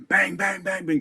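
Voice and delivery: high, loud vocal bangs; imitated gunshots. Soldier shooting at two running men, imitated gunshots. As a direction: punch out each mimicked shot.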